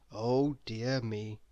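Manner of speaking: ordinary delivery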